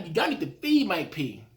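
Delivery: ordinary talk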